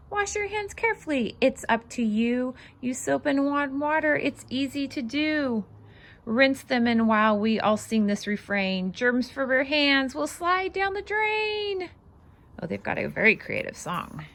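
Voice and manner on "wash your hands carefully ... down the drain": lively, bouncy read-aloud voice; singsong picture book reading; reading to children. Acted. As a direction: read it out like a storybook for little ones.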